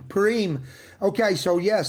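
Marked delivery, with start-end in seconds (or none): 0.1-0.6 "Purim" said as puh-REEM; long final ee. Shown as a demonstration. Speaker repeating partner's version, "Purim" as puh-REEM. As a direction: say "Purim" with a full -reem at the end.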